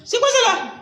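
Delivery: a teasing, cartoonish squeal; mock squeaky voice. To his friend mocking the English talk, a squeaky mock question. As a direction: jump up very high and squeak the question.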